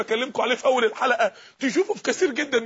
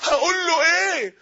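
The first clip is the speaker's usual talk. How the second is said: higher, drawn-out anguished question; slow, raised cry of dread; acted dread; 5 semitones higher, 4 dB louder, slower than usual. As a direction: act out a dying man's terror. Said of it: "بدأ يترعب".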